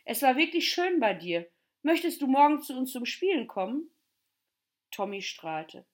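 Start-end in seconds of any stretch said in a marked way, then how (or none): 0.1-3.9 loud, high-pitched friendly invitation; calling out. Acted. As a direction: call out an eager invitation. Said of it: "riefen die letzten Gäste".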